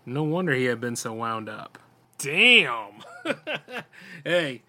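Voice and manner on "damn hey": startled laugh. Real, not acted. Narrator reacts laughing.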